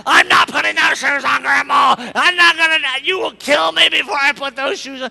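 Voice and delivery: put-on loud, stubborn little-girl yell; defiant child shout. Kate yelling back at her grandma, defiant shout. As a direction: scream your refusal like a four-year-old.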